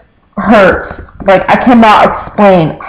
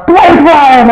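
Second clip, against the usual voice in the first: raised, higher scolding call of his full name; mom yelling his name; 7 semitones higher than usual. Retold or imitated. Mom catching her son doing something, a raised scolding call. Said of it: "but my mom yells".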